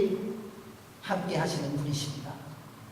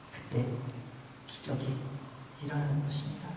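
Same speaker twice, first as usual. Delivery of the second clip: hushed preaching; 2 semitones lower, 7 dB quieter than usual. Acted. Pastor gently urging the congregation to obey, hushed. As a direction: lean in and almost whisper the call to obey. Soft exhortation after loud line.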